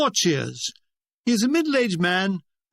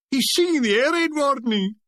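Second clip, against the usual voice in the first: higher, comic cry of dismay; mock alarm; 5 semitones higher than usual. A narrator's reading. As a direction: call out the shocking discovery. Spectator cries out at singing.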